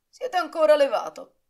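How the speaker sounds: domanda stupita, più acuta e forte; stupito. Acted. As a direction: sorpreso di trovarlo ancora sveglio. Coriolano sorpreso di trovarlo sveglio. (Coriolano entra stupito nella camera di Blasco, forte e acuto)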